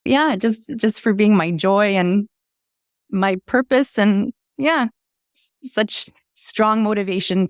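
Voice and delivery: high, slow, teary voice; holding back tears. Real, not acted. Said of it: "I'm getting teary".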